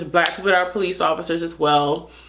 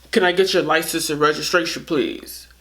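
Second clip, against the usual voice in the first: police officer; 4 semitones lower than usual. Retold or imitated. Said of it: "and he's like".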